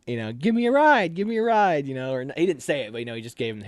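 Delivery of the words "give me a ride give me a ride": high, loud begging call; pleading for a ride. Retold or imitated. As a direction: beg for a ride from the truck, pitched up. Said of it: "Chad was like".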